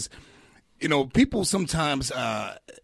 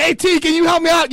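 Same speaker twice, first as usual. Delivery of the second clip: high, loud pleading put-on voice; hammed-up begging comic, pitched way up; mock needy comic; 9 dB louder than usual. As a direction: squeak out the favour like a pest. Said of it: "Yeah, like".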